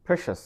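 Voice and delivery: "precious" with PRESH stressed; stress first syllable. Shown as a demonstration. Teacher showing where you have to stress, first-syllable stress. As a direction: lean on the first syllable, "PRE-cious". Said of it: "you have to stress".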